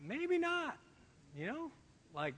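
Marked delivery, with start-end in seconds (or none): 0.0-0.8 high, very soft doubtful aside; hushed, hesitant inner voice; thinking to himself. Acted. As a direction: murmur a private doubt, pitched up. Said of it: "I'm thinking to myself".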